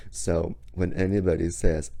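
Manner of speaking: ordinary talk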